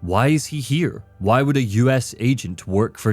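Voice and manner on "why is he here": ordinary talk, nothing marked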